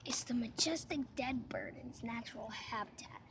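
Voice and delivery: hushed wildlife narrator whisper; nature documentary. Pretend. Whispered wildlife narration of dead bird.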